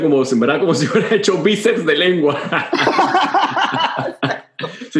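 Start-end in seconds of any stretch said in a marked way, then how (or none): 2.4-4.8 high, sustained burst of laughter; long giggling cackle; real laugh. Real, not acted. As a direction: crack up at your own joke. Laughs at own tongue remark.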